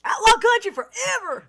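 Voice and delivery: high, loud rallying holler; mock country war cry; hipster country guy. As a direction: holler it like an outlaw country fan. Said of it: "He's a hipster country guy".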